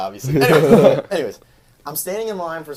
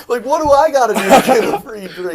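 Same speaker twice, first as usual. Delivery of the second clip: loud, high hammed-up plea for a free drink; mock plea; 12 semitones higher, 13 dB louder than usual. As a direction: beg the barista, laughing.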